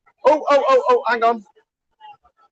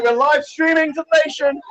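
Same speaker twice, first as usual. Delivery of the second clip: normal talking voice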